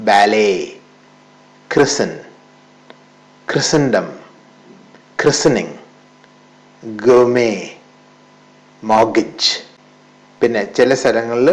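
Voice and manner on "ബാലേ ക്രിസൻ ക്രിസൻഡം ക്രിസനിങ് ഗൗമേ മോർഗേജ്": ബാലേ said "ba-lay", with no t; ബാലേ, ക്രിസൻ, മോർഗേജ് with the t dropped; silent t. Shown as a demonstration. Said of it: "ഇങ്ങനെയാണ് pronounce ചെയ്യുക". (ടി ഉച്ചരിക്കാതെ)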